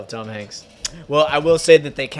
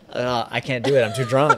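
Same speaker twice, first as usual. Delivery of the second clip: normal talking voice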